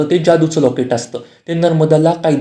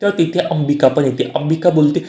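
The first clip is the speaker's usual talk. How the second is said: usual speaking voice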